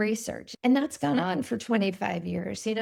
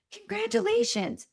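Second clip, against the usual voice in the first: much higher, excited imitation of a proud dad; retold elated encouragement; 12 semitones higher than usual. Father to his discouraged daughter on the phone, imitated elation. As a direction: act out a dad thrilled by bad news.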